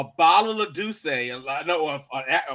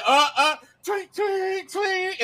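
high-pitched mock whistle tweets; imitated whistle blowing; 12 semitones higher, slower than usual. Retelling a friend blowing his whistle at brunch, imitated whistle. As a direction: mimic blasts on a party whistle. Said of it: "he started blowing".

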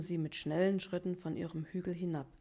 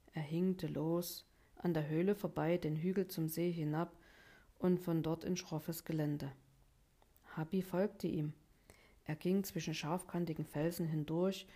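ordinary delivery, nothing marked

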